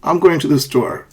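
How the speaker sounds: "store" glides down; falling statement. Shown as a demonstration. Teacher answers where are you going, falling intonation. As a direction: finish with pitch down on "store". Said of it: "there is a falling intonation".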